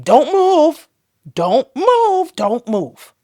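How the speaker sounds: high, loud repeated urging; urgent command. Retold or imitated. Staff to mother on the table, urgent loud command. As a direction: call out urgently to keep her still.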